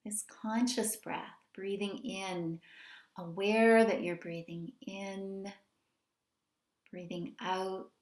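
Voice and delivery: unhurried meditation guidance with long pauses; guided meditation. Real, not acted. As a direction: gently lead them into settling, phrase by phrase.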